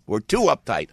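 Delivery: usual speaking voice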